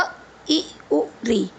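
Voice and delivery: अ, इ, उ, री each clipped short and light; quick short vowel sounds. Shown as a demonstration. Teacher to children, short vowels said quick. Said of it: "आपने देखा कितने हमने आराम से बोल दिया".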